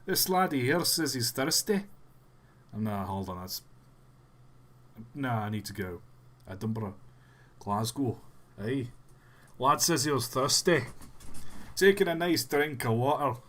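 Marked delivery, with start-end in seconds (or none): 0.1-1.8 put-on Scottish accent; playful Scottish brogue. Shown as a demonstration. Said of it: "Please have a Scottish accent". 6.5-13.4 finding a Scottish accent through place names; playful Scottish lilt. Shown as a demonstration. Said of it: "Please have a Scottish accent".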